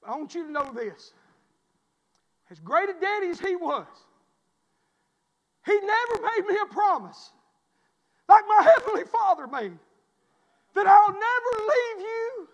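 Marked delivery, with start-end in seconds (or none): 2.5-12.5 preaching at full force. Real, not acted. Pastor telling the congregation of God's promise, soaring preaching. Preaching climax, far timbre.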